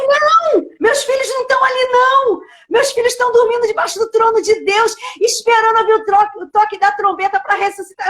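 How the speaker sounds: voz aguda, negação veemente ao recontar; protesto chocado reencenado. Retold or imitated. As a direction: reencene um protesto enfático, em tom mais alto. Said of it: "parece que eu tomei um choque". (protesto veemente e agudo, revivido)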